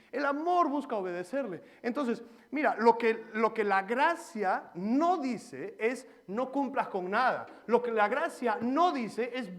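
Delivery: loud, high fervent sermon delivery; impassioned preaching. Acted. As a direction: drive the point home to the congregation. Preaching at full force.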